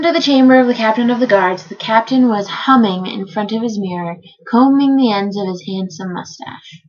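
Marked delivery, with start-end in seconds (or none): none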